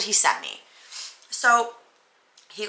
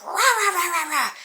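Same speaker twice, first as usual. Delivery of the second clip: high mock dog barks; imitating a snarling dog, much higher; pit bull barking; 11 semitones higher than usual. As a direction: bark it like an angry pit bull. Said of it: "like a pit bull".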